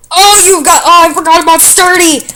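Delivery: high, loud startled cry; surprised outburst. Real, not acted. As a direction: blurt it out in shock. Opponent survives with Sturdy.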